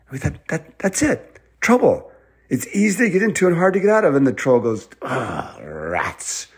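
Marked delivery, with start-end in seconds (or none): 0.5-4.1 put-on high, eager kid voice; thrilled, speeding up as it clicks; excited discovery. Children solve the first riddle. 5.0-6.5 low groaning troll voice; drawn-out growly dismay; frustrated troll. Acted. Troll beaten at riddle.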